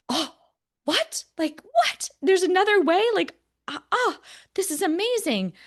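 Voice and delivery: high-pitched, gushing astonishment; re-enacted wide-eyed wonder, voice leaping up; amazed disbelief. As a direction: act out being blown away by a revelation. Re-enacts amazed reaction at conversion.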